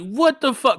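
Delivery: high incredulous what the fuck; disbelief. Retold or imitated. Comic retelling his reaction, high incredulous outrage. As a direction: hit it high in shock.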